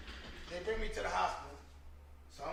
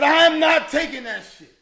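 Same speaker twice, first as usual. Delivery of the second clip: loud, high, slow refusal; retold shouted refusal of the needle; defiant refusal; 9 semitones higher, 9 dB louder than usual. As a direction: yell it back, refusing flat out.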